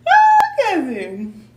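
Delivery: overjoyed drawn-out cry; excited. Real, not acted. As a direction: gush it to the viewers. Overjoyed at likes on live.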